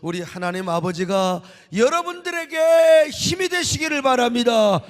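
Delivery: high, loud, ringing blessing; fervent preacher's climax; preaching at full force. Acted. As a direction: drive the blessing home to the congregation.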